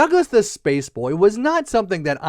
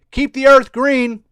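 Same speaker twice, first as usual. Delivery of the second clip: high, loud proclamation; punchy raised slogan; slogan call; 6 semitones higher, 7 dB louder than usual. Pretend. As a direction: announce it like a public service message. Mock public message.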